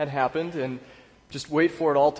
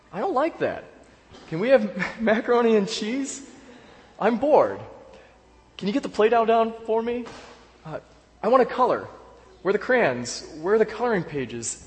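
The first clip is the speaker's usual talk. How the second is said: put-on higher childlike voice, whining questions at dad; imitating a whiny child; 6 semitones higher than usual. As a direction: play the pestering little daughter. Pastor voices his nagging daughter.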